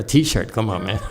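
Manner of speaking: usual speaking voice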